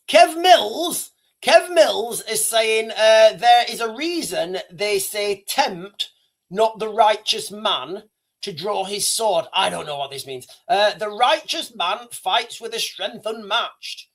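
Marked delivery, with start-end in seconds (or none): 3.3-9.5 slow solemn reading. Acted. Reading a chat quote aloud. 11.0-14.1 slow solemn reading. Acted. Reading a chat quote aloud.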